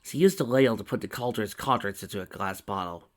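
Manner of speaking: ordinary delivery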